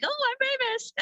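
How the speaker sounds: very high, joking self-mockery; mock famous. Vlogger joking about her reels getting five hundred views, very high mock pride. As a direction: squeal it like a tongue-in-cheek celebrity. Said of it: "I'm like".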